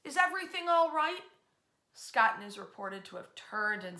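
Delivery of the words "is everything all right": called out, higher and louder; raised hail to a man in the dark; hailing him. Retold or imitated. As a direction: call across the yard to the figure. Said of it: "they hail him".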